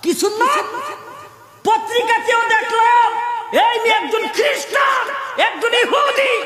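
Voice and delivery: very high, sustained sermon chant; full-force preaching. Acted. Preacher telling a crowd of a researcher's conversion, high chanted preaching. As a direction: cry it out high and chanted, easing down at the end.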